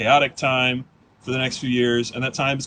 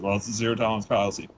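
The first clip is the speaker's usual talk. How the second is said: lower, softer, fast deadpan; bored official; 4 semitones lower, 5 dB quieter, faster than usual. Retold or imitated. Official to student, quick soft brush-off. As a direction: rattle off the policy dismissively. School official quoted again.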